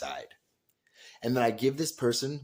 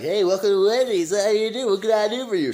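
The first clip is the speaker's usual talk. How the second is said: loud, high, over-cheerful fast-food welcome; booming counter-worker enthusiasm; jolly greeting; 9 semitones higher, 8 dB louder than usual. Retold or imitated. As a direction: greet the customer with huge pep. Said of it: "You're in a jolly mood".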